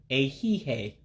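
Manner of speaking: "a he, hey" said slowly for learners; careful teaching pace; slow demonstration. Shown as a demonstration. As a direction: sound out the word slowly. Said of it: "Again, slowly".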